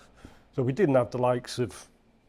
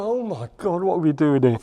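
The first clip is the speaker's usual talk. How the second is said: retold alarm, higher and louder; mock panic; 4 semitones higher, 7 dB louder than usual. Telling friends' reaction to bombs overhead, in mock panic. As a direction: exclaim it as a remembered panic.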